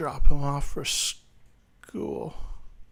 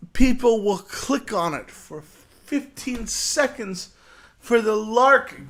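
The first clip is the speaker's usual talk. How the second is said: raised, high-pitched ranting with long pauses; exasperated rant; 6 semitones higher, 4 dB louder than usual. Acted. Telling podcast hosts these things end, exasperated rant. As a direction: lecture them loudly, hammering each phrase.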